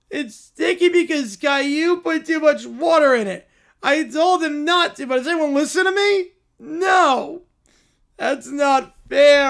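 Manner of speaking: put-on high, loud, slow childish whine; whiny kid. Kids whine over pizza.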